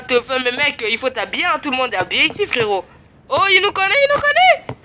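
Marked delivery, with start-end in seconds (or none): 3.3-4.8 climbing much higher and louder; excited squeal. Real, not acted. Streamer realizing a new player knows him, squealing high. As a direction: burst with glee.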